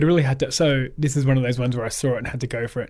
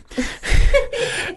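high, airy burst of laughter; self-mocking laugh; 4 dB louder than usual. Real, not acted. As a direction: crack up at your own confession. Laughs at own running joke.

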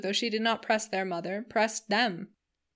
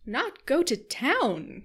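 high, slow, drawn-out cry of disbelief; mock dismay; 6 semitones higher, 4 dB louder, much slower than usual. Missus Palmer protesting to the sisters, a high drawn-out cry. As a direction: exclaim as if the idea is absurd. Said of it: "cried Missus Palmer with a laugh".

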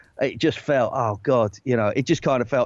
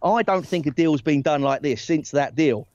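normal talking voice

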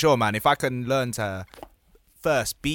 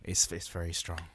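softly; 7 semitones lower, 10 dB quieter than usual. Acted. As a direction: drop it low and soft. Bromance joke, repeated.

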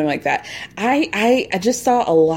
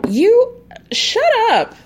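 high, slow mock telling-off, a bit louder; shut up; 13 semitones higher, 4 dB louder, much slower than usual. Real, not acted. Host snapping at Brock on camera, drawn-out high scold. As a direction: stretch it out like scolding a brat.

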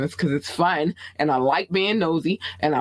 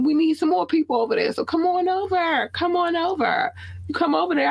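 calling out; 14 semitones higher than usual. Real, not acted. Calling viewers over, sing-song.

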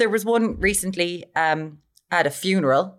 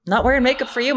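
ordinary delivery

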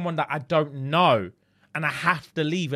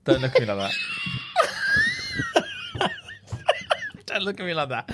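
cracking up mid sentence; laughing too hard to speak. Real, not acted. Laughing fit, friend's look.

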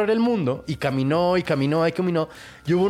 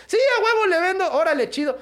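high excited squeal of a greedy miller; eager yes; 14 semitones higher than usual. Acted. Miller squealing yes to the stranger, giddy and high. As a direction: jump at the deal, pitch way up. Father eagerly accepts devil's deal.

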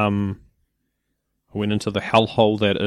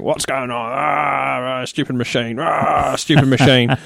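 mock groaning rant, pitched up; nutting out; 4 semitones higher, slower than usual. Imitating the woman freaking out at self-checkout, mock-exasperated. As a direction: imitate a woman losing it at a machine. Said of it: "hear the woman next to me just nutting out".

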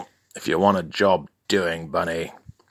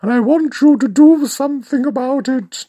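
high, louder put-on client; high-pitched client voice; 6 dB louder, faster than usual. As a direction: squeak it out as the posh client. Client voiced in comic story.

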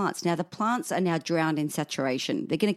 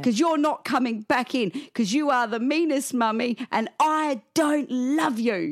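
high, mock-furious little kid; retold tantrum, pitched up and drawn out at the end; shouting child; 7 semitones higher than usual. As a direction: imitate a child's cross declaration. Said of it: "he's in there shouting things".

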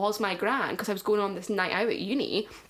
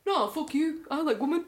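higher defiant comeback, half joking; 7 semitones higher than usual. Pretend. Facing a homophobe, a playful defiant comeback. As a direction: snap back at a homophobe, lightly mocked. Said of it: "That's my, like, fighting voice".

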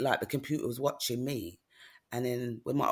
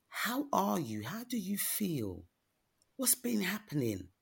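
"how are you" asked higher, leaning on ARE; pointed, caring question; 7 semitones higher, slower than usual. Retold or imitated. Doctor asks patient how she really feels, pointed, caring question. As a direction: press "how are you" slowly, wanting the real answer. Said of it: "And she goes, "No".

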